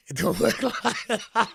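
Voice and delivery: high, slow, laughing through it; chuckling at the inmate's naivety. Real, not acted. Telling the lovesick inmate the truth, laughing.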